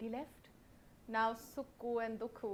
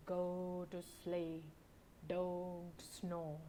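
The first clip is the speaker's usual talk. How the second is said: slow, low, softer watchman call; night watchman; 5 semitones lower, 4 dB quieter, much slower than usual. Acted. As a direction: drone it like a night patrol.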